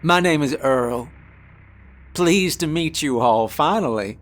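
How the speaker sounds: loud, hearty Southern drawl; hard Southern drawl. A narrator's reading. Expedition boss introduces himself to the crew, hard Southern drawl. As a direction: introduce yourself with a big Southern twang. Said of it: "said in a hard Southern drawl".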